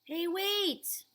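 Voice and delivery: high, loud call to stop someone. Acted. Old man calling after the boy, loud and high. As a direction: shout after someone leaving.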